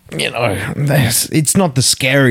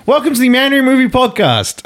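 host intro; 13 semitones higher than usual. Acted. Restarting intro again.

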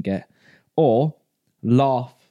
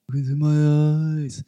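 Cueto imitation; 3 semitones higher, much slower than usual. Imitating Cueto from Total Recall.

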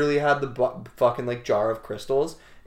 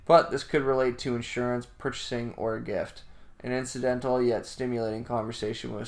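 reading a horoscope aloud; steady read-aloud voice; 4 dB quieter than usual. Acted.

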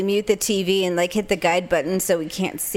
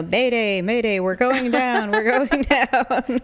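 mayday radio call; 3 semitones higher than usual. Retold or imitated. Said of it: "see if I can be like".

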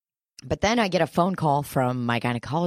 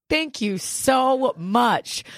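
mock gushing thanks; 7 semitones higher, much slower than usual. Relieved at chlamydia news.

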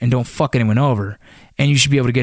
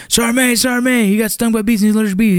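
retold scream; 9 semitones higher than usual. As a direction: yell for the sergeant in a panicked rush. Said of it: "And I'm screaming".

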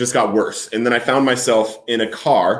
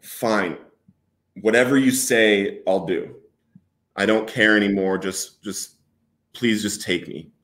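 giving up; 3 semitones lower than usual. Retold or imitated. Man conceding to God in the car, weary giving up. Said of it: "a giving up".

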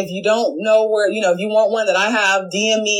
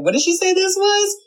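mock puzzled; 11 semitones higher than usual. Viewers judging blurry food video.

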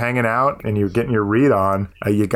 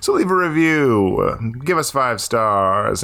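slow, drawn-out plea; slow and deliberate; slower than usual. Real, not acted. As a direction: stretch the ask out. Podcast outro plea.

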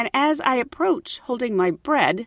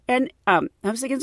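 normal talking voice

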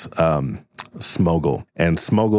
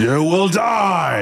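villain shout; 10 semitones higher, much slower than usual. Acted. Villain threatens.

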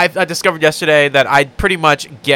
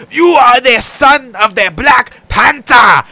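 slow, booming fake African accent; hammed-up, high declamation in a bad African accent; Forest Whitaker; 6 semitones higher, 4 dB louder, slower than usual. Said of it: "I love fucking Forrest Whitaker".

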